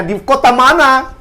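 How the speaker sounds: very high, loud, drawn-out question; retold eager question. Woman asks which town he is from, high retold question.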